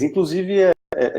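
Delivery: usual speaking voice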